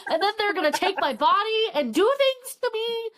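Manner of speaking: high, hammed-up panicky spiral; playful imitation of someone freaking out; mock panic. Acts out character spiralling over death.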